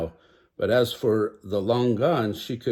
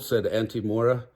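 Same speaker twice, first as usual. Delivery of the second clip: ordinary delivery, nothing marked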